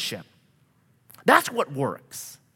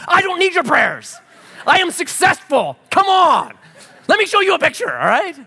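high, loud proud retort; mock boast, high and loud; indignant retort; 13 semitones higher, 6 dB louder than usual. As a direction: fire back, much higher and louder. Acted proud inner retort.

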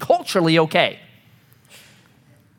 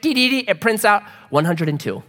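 ordinary talk

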